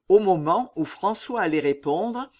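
usual speaking voice

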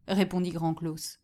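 usual speaking voice

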